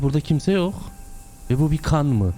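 ordinary talk

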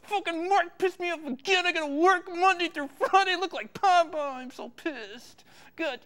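ordinary talk

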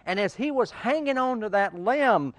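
preacher's dramatic storytelling; building story tension. Acted. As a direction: tell it with rising suspense.

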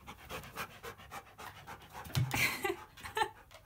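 a long airy burst of laughter; breathy laugh. Real, not acted. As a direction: laugh with relief at the good dog. Confused dog makes her laugh.

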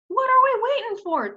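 high, loud bright chirp. Acted. Meadowlark eager to fly toward the ranch. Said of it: "chirped June".

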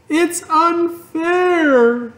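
very high, very slow wail; sobbing. A narrator's reading. Fern sobs to her father, very high. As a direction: wail it through tears. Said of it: "she sobbed".

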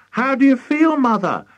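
high, loud, worried question; high-pitched anxious daughter. A narrator's reading. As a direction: ask in a high, fretful voice. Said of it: "asked Missus Cranky anxiously".

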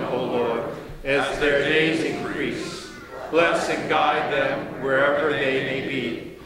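slow, low, measured prayer voice; slow liturgical blessing fading to a hush; solemn prayer. Acted. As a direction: pray it slowly over the children, then bless them.

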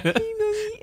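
put-on high babyish coo. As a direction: coo like a grown man babying. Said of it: "and he does the baby voice".

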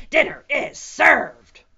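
very loud, high, slow proclamation; T-Rex roar. A narrator's reading. T-Rex announces his meal at last, roaring. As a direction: bellow it in triumph. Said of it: "roared the T-Rex".

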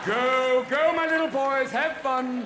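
loud, high, cheering them on; indulgent send-off. Acted. Officials waving untested snowboarders off, indulgent calling out. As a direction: call out to the kids as they go.

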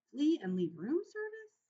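as if the idea were absurd; bewildered. A narrator's reading. Ralph bewildered by fleeing.

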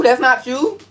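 high, loud teasing disbelief; mock shocked. S0 teasing S1 about the blue do-rag, mock outrage. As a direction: act outraged he'd deny it.

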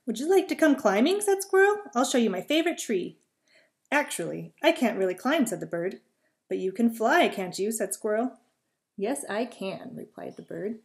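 lively read-aloud voice with little character voices; storytelling. A narrator's reading. Reading a squirrel and bird story to children, lively. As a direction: tell a bedtime story to young listeners.

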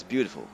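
"beautiful" said as "beaudiful". Shown as a demonstration. Showing viewers the wrong way, "beautiful" with a D. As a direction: swap the T in "beautiful" for D.

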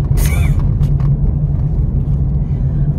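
loud unvoiced choking sob, demonstrated; mock choked-up crying. Shown as a demonstration. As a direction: gasp as if crying till you choke. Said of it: "about to choke type of crier".

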